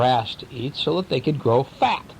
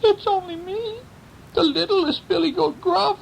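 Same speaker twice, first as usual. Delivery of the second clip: much higher squeaky little goat; tiny voice. Acted. Littlest goat answers the troll in a high tiny voice. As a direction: make yourself small and squeak. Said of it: "spoke in a tiny voice".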